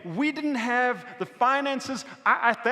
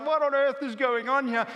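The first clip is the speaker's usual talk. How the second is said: mock-bewildered outburst, pitched up; retold baffled exclamation, higher voice; 6 semitones higher, 4 dB louder than usual. Teenager watching the couple fight, bewildered. Josh reacting to their fighting.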